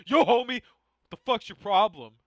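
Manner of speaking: high, very loud challenge, slowing at the end; hammed-up shout squaring up to a troublemaker; mock confrontation. Imagined passenger confronting a crazy rider, mock confrontation. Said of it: "imagine, like, the putting in check process".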